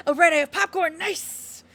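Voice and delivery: laughing while she talks. Real, not acted. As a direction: giggle through the words. Remembers snack mid-laugh.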